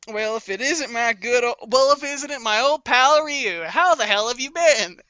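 hammed-up joyful reunion; hearty greeting. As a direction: greet a long-lost pal with big gusto. Greeting old pal in character.

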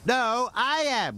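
high, drawn-out rival engine; high, slow contradiction; Rheneas wheezing. A narrator's reading. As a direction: insist on it in a high voice. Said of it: "wheezed Rheneas".

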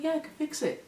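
put-on young man. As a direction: reassure her casually as the young man.

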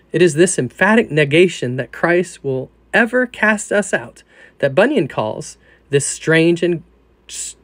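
ordinary delivery

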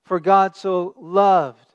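slow, loud, leaning on loved; stress on loved. Shown as a demonstration. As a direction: draw out the word loved. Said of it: "emphasize loved".

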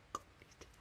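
very soft voiceless repeat; barely audible hushed murmur; whispered echo. Real, not acted. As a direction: whisper it as an aside. Hushed echo of previous words.

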